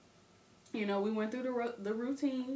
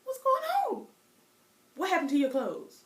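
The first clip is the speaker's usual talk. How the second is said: put-on much higher, louder startled child; a girl confused by grandma's anger; alarmed kid; 11 semitones higher, 7 dB louder than usual. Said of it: "I'm like".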